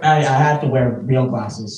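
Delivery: ordinary talk, nothing marked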